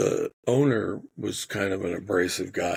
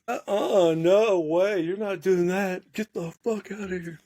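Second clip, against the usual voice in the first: high, raised mock yell; 10 semitones higher than usual. Scared by a man pointing, a high yell. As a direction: yell at him to get out. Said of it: "So I just yelled at him".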